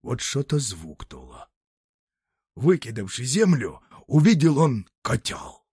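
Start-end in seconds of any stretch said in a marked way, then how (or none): none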